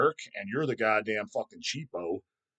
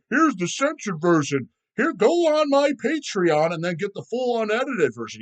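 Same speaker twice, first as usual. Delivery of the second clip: higher voice, hammed-up creator advert; mock sales pitch; 7 semitones higher, 4 dB louder than usual. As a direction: parody a creator pushing Patreon. Mocking other creators' paywall pitch.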